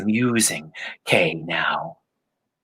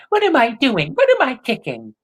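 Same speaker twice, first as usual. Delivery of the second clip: high mocking echo of grandma's scolding; mocking imitation; 12 semitones higher, 3 dB louder than usual. A narrator's reading. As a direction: throw her words back at her, sneering. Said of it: "He imitated her voice in a mocking way".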